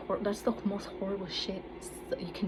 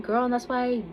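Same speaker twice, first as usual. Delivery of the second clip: put-on talking back to the girl who insulted her, louder and higher; louder, higher retort; 5 semitones higher, 9 dB louder than usual. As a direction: raise it high and loud at the mean girl. Snapping back at mean girl.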